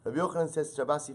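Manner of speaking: ordinary talk, nothing marked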